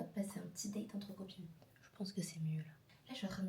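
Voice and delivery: quiet whispered morning chat; hushed whisper. Real, not acted. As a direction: keep it hushed, like not to wake anyone.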